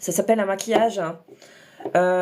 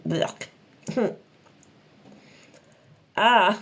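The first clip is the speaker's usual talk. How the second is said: talking while brushing teeth; words round a toothbrush; 4 semitones lower, much slower than usual. Real, not acted. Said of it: "je me brosse les dents".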